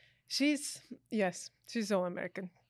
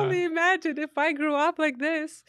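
higher, louder, voice on the edge of tears; half laughing, half tearing up; teary; 8 semitones higher, 7 dB louder than usual. Retold or imitated. At her kid's dentist, choking up, high and teary. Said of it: "I'm like".

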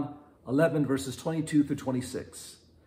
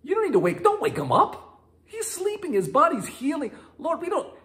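disciples protesting; 14 semitones higher, 3 dB louder than usual. Retold or imitated. Disciples talking Jesus out of waking Lazarus, high and pleading. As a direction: beg Jesus not to go, voice up high. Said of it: "the disciples are like".

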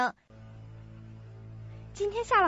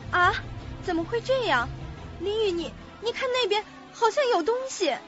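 high, thin-voiced alarm; acted rising fear; frightened girl. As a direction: point out something scary in a high, nervous voice. Frightened girl spots something in graveyard.

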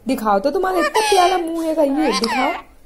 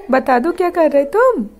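ordinary delivery

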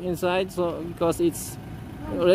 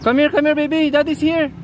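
coaxing pet call; 9 semitones higher, faster than usual. Real, not acted. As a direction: coax the pet over. Dad coaxing scared pet closer.

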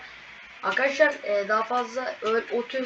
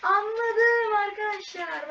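dawning realisation; 10 semitones higher, much slower than usual. Real, not acted. Sudden realisation mid-game.

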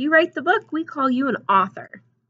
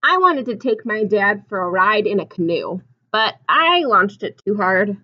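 storybook reading; 7 dB louder than usual. A narrator's reading. Reads picture book aloud.

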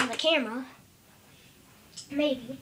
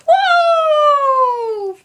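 high, loud cartoon whoa; excited whoa; 10 semitones higher, 9 dB louder than usual. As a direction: cry out like a thrilled toy. Toy dog does a trick.

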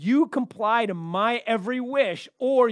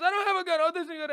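high, panicky protest; a frightened man explaining; scared man; 10 semitones higher, faster than usual. Retold or imitated. S1 voicing the exterminator, frightened. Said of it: "He goes".

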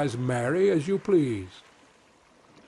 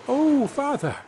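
put-on high, bright little-boy cry; a small boy's high, eager cry to his father; Tom crying out; 11 semitones higher, 4 dB louder than usual. A narrator's reading. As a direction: call out eagerly as tiny Tom. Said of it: "cried Tom".

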